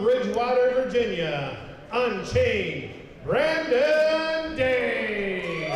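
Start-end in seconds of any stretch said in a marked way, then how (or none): 1.9-5.7 drawn-out name call. Acted. Ring announcer calls the challenger's name.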